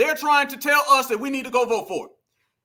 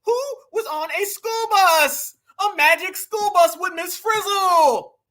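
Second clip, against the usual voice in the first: high, slow mocking Karen voice; Karen Kamala; 4 semitones higher, slower than usual. Vlogger mocking her school bus speech, exaggerated Karen voice. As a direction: draw it out like a hammed-up Karen. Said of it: "The Karen came out of her".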